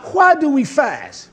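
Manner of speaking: high, slow, loud question; acted loud complaint. The people question Yah about fasting, acted loud complaint. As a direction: draw out the protest in a preacher's cry. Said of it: "They say".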